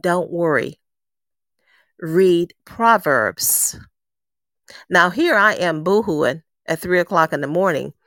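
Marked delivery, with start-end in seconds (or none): 0.0-3.8 slow calm divine command, each word set apart; a lightly voiced God, gentle and very slow. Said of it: "That voice spoke to me and said".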